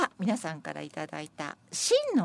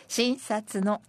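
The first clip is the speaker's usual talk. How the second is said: slow, projected, higher verse reading; senryu recitation; 4 semitones higher, 3 dB louder, much slower than usual. Acted. Presenter reading senryu wishing for good economy, slow recitation. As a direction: declaim it slowly in separate phrases.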